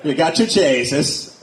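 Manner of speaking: Jesus said as "Jay-zus", the E turned to an A; slow, stretch Jesus into "Jay-zus" with a mock Irish A. Shown as a demonstration. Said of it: "Just take your E's and turn 'em into A's".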